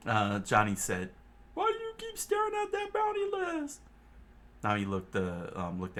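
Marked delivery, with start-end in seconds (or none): 1.5-3.8 much higher curious question; high character voice. Acted. Johnny asking Nami about the bounties, high. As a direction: ask her curiously.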